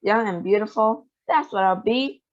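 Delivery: ordinary delivery, nothing marked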